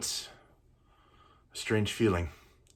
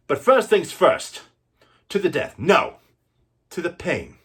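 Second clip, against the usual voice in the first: loud challenge; 8 semitones higher, 6 dB louder than usual. Acted. Wesley loudly challenges the prince to a duel. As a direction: throw down the gauntlet.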